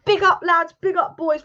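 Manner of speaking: mock pep talk to the lads; hyping-up Oli. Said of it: "Oli will be going".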